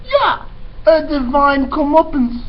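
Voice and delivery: put-on high, loud, brash girl voice; eager Mig. Teacher voicing dim servant girl Mig, high and loud. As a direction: pitch way up and blurt it out loud.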